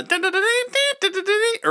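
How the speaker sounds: high, honking musical car horn; mimicked obnoxious novelty horn; imitated car horn. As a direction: toot a horn tune on "dun". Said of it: "the obnoxious car horn".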